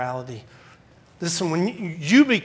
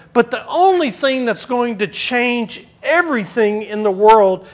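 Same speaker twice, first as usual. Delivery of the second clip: preaching at full force; 5 semitones higher, 7 dB louder than usual. Acted. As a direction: drive the point home to the church. Sermon climbs to full force.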